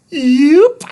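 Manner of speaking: high beeping robot voice, broken grammar; robot Thud. A narrator's reading. Little robot explaining follicles, high beep first. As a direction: beep then explain simply.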